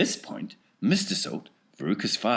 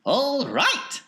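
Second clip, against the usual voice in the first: Wonka voice; 8 dB louder than usual. Acted.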